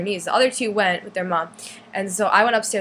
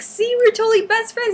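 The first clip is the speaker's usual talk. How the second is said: high, loud excited boast; gushing fan; 13 semitones higher, 5 dB louder than usual. Retold or imitated. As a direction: show off to your idol, high and loud. Boasting to the idol, retold.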